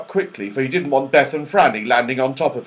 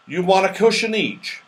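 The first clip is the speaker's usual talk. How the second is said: higher, brighter character voice for Moonface; 6 semitones higher than usual. Acted. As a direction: Moonface offering the children cushions, higher voice. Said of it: "he said".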